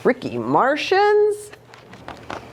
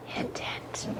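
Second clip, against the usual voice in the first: whispered hint; 16 dB quieter than usual. Real, not acted. Mom hints about Mother's Day.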